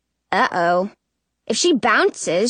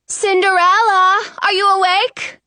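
calling up the stairs, higher; 8 semitones higher, 4 dB louder than usual. A narrator's reading. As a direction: call out from downstairs. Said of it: "cries a voice from downstairs".

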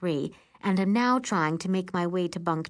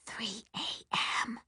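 hushed, voiceless whisper; 4 semitones higher, 9 dB quieter than usual. Acted. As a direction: whisper it so the bunkmates stay asleep. Whispered reply in sleeping cabin.

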